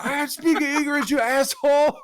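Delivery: high-pitched, drawn-out accented cartoon outrage; imitated angry yelling. Owner snapping back at his rival, imitated yelling. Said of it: "yelling at him".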